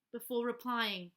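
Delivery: ordinary delivery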